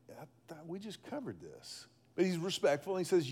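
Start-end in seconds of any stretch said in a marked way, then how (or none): 0.1-1.9 much softer, muttered under the breath; a weary half-voiced aside; exasperated aside. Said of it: "Peter's kinda like".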